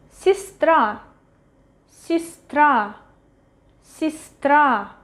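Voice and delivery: Сестра with е as i, stress on the final a; reduced unstressed Ye. Shown as a demonstration. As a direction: say сестра as sis-TRA, carefully modelled. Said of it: "pronounced as И".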